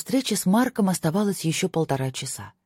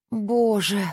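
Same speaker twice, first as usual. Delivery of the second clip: higher, drawn-out moan; groaned; 6 semitones higher than usual. A narrator's reading. Narrator drops head on hands, groaning. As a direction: groan it out in despair. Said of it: "простонала я".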